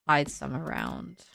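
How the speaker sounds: normal talking voice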